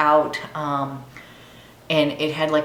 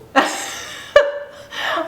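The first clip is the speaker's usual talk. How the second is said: high breathy giggle; 12 semitones higher than usual. Real, not acted. Laughing at own swollen face.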